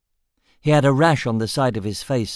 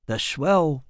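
exclaiming; 4 semitones higher than usual. A narrator's reading.

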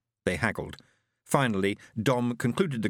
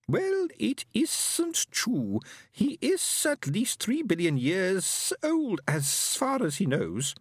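high, slow alien voice; a lofty alien speaking slowly and high; stately high alien voice; alien Fenobe; 12 semitones higher, much slower than usual. Acted.

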